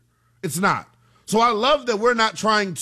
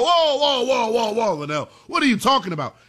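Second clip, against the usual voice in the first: raised-pitch mock protest of whoa, whoa; imitated objection; 5 semitones higher than usual. Imagined listeners balking at the comparison, mock-protesting. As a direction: imitate people jumping in to object, higher at the start. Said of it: "and have people go".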